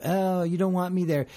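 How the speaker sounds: ordinary talk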